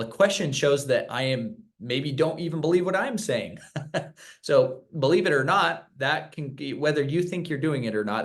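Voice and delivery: normal talking voice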